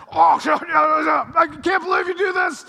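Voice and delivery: high, loud mocking shout of an irate board member; mock angry yell. Preacher mimicking an angry school board member, loud mock shout. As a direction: ham up the ticked-off person yelling. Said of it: "They're yelling".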